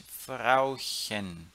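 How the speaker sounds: "Frauchen" with soft hissed "chen" after "Frau"; soft Chen despite U. Shown as a demonstration. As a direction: keep the ch of "Frauchen" soft, not throaty. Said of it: "ele é sempre pronunciado Chen". ("Frauchen" com Chen suave, como em ich)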